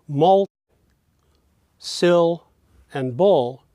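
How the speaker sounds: "mul, sil, and bul" picked out one by one with pauses. Shown as a demonstration. Lecturer pointing out high pitches, "mul, sil, and bul" each stressed separately. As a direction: isolate each stressed syllable, "sil" highest. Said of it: "being the higher pitches".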